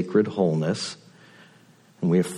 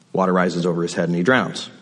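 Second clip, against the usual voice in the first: usual speaking voice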